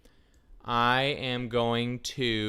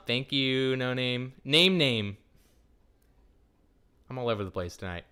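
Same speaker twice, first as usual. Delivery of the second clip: ordinary talk, nothing marked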